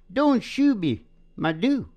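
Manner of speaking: usual speaking voice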